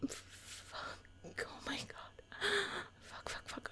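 strained, breathy gasps of words; breathless whisper. Real, not acted. Overwhelmed mid-challenge, breathless gasping whisper. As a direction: gasp the words out under breath.

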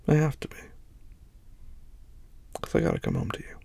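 ordinary delivery

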